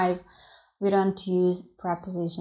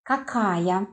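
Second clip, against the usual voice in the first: ordinary talk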